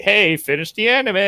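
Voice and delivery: mock-bossy call to finish the show; nagging friend. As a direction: prod him like an impatient friend. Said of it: "And I'm like".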